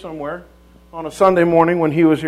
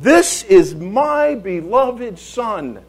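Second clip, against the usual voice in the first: very slow, raised, drawn-out proclamation; God announcing; 4 semitones higher, much slower than usual. Acted. God announcing His Son to the world, slow booming proclamation. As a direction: proclaim it to the whole world, word by word. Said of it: "God is announcing to the world".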